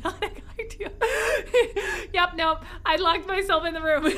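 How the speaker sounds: high giggling through the words; real giggles, high and breathless; laughing. Real, not acted. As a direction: laugh helplessly while talking. Laughing at herself.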